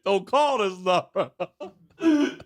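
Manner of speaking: slow, drawn-out plea breaking into laughter; laughing plea. Retold or imitated. Narrator repeats the plea very slowly while laughing. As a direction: stretch it out and laugh through it.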